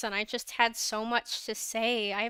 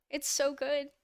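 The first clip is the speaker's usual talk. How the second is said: ordinary delivery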